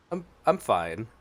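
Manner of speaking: usual speaking voice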